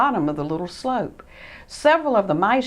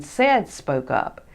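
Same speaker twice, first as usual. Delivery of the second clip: plain reading voice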